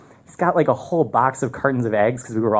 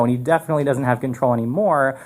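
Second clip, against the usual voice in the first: normal talking voice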